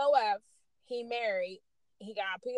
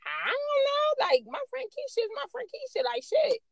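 exasperated; 12 semitones higher, 3 dB louder than usual. Retold or imitated. Retold annoyed reply to friend.

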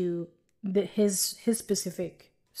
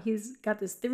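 ordinary delivery

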